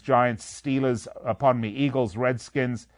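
ordinary talk

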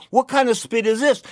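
loud, high-pitched protest; retold indignant outburst. Customer confronts the water lady over price, retold indignant outburst. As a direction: replay the outrage at the seller.

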